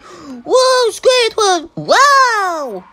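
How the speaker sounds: sudden high-pitched squeal of surprise; excited squeal. Real, not acted. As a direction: shriek his name in delight. Spots Squidward in the street.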